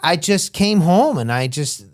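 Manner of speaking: ordinary talk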